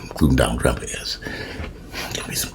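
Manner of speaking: unvoiced gleeful whisper; conspiratorial whisper. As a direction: hiss the aside under your breath. Gleeful aside.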